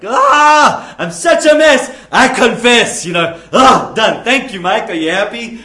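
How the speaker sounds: hammed-up grudging apology; mock huffy confession. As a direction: whine it out like a sulky teen forced to say sorry. Mocking a grudging forced confession.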